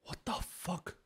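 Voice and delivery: stunned, breathless whisper; shocked whisper. Real, not acted. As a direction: gasp it out under your breath.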